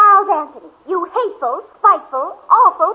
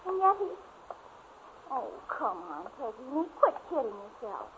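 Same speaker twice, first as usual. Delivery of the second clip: muttering to herself; 7 semitones lower, 6 dB quieter than usual. Acted. Girl alone, furious then lovesick.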